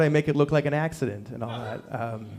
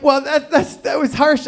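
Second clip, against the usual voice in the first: high, stammered astonishment; taken aback; 9 semitones higher, 3 dB louder than usual. Retold or imitated. Dad to six-year-old after her warning, high startled stammer. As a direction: stumble over it in a raised pitch.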